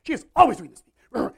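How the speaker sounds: high, loud exasperated grumble; fuming Martha. Acted. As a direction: vent like a frustrated sister. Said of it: "she just starts fuming and getting more and more upset".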